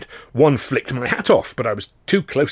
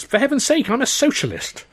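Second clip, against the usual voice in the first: exasperated thought; 14 semitones higher than usual. Acted.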